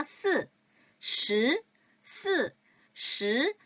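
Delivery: 스 sharp, 시 retroflex; si versus shi. Shown as a demonstration. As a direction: contrast 스 and 시 in turn. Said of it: "다시 한번요".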